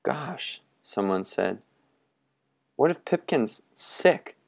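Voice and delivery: soft, high and worried; hushed worry. Acted. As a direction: whisper the fear. Fearful hush.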